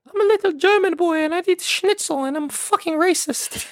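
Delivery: put-on very high little German boy accent; hammed-up, high-pitched German caricature; exaggerated German accent. Shown as a demonstration. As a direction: squeak it out in a comic German accent. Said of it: "What's your best German accent?".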